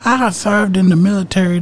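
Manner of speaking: mock the driver's outrage in a Middle Eastern accent. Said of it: "He had a heavy accent".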